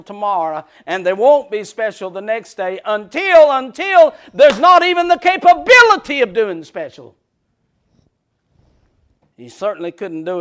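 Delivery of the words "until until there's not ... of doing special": rising to a loud preaching climax; preaching shout. Real, not acted. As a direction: build and shout the end.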